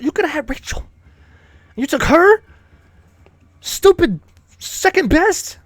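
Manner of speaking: high-pitched, disbelieving rebuke; incredulous scolding. Pretend. Streamer scolding Ryu for passing up Rachel, high and incredulous. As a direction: squeal it in outraged disbelief. Berates game hero's choice of woman.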